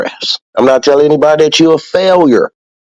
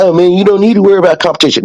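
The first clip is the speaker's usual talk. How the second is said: fast, higher mock voice; mocking naysayers; 6 semitones higher, much faster than usual. Mimicking people who brush off competition, fast mock voice. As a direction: rattle it off like a dismissive friend. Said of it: "people are like".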